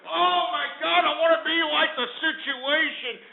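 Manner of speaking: high-pitched mock gushing fan. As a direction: mimic a starstruck fan with scorn. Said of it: "everybody's just kind of".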